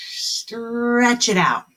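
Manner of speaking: very slow, drawn-out words; slow stretchy snake voice; stretched out. Shown as a demonstration. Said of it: "like stretchy snake".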